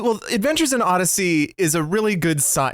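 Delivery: ordinary talk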